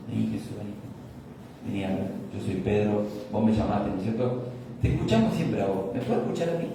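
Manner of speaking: soft, persuasive murmur to Jesus; confiding into his ear. Retold or imitated. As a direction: coax him quietly, close to the ear. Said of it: "le empieza a hablar en el oído". (Pedro susurrando al oído de Jesús para convencerlo)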